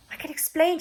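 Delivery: high, flustered plea; spluttering plea. Acted. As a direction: scramble to explain. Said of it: "she spluttered".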